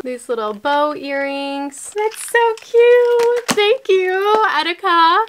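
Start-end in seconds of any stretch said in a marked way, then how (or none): 2.0-5.2 high, loud, drawn-out squeal of delight; very high gushing coo. Real, not acted. Gushing over a gift.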